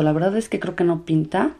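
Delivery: normal talking voice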